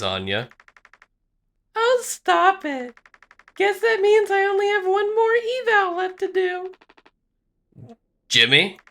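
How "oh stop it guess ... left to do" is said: high feminine character voice; high female voice. Acted. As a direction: play the evaluator up high. Female evaluator voice continues.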